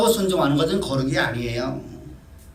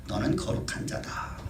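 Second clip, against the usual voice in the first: slow whispered declaration; God whispering to David that he is holy; whispered blessing; 9 dB quieter, slower than usual. Acted. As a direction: breathe the words like a secret gift.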